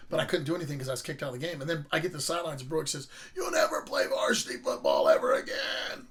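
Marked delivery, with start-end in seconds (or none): 3.3-6.1 slow, strained growl of a scolding coach; mock a coach's drawn-out telling-off; imitating angry coach. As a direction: drag it out in a rough, put-on voice. Coach scolds him on the sideline.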